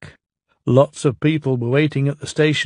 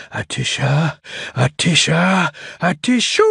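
big sneeze; 3 semitones higher than usual. Acted. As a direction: wind up and let the sneeze burst. Engine sneezes at the bridge.

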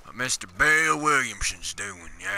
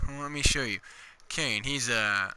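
ordinary delivery

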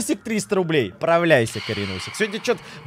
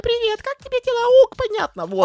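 high synthetic female voice; женский голос TTS; 13 semitones higher than usual. Pretend. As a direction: read it like a donation bot. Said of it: "Выбирай женский голос". (женский голос озвучки доната)

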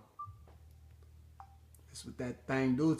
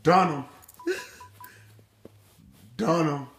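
loud; 3 semitones higher, 13 dB louder than usual. Real, not acted. Friend jokes he's done.

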